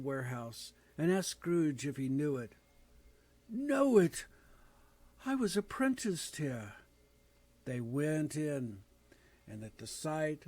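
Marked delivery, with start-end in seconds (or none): 3.5-6.7 much higher, sudden astonished burst; surprised. Acted. Scrooge answering the ghost about the warehouse, astonished. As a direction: caught off guard by an old memory.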